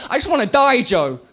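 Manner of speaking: loud, high cry of despair; a desperate scream at Joe; anguished shout. Acted. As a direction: yell it out high and raw. Shouted opening line of poem.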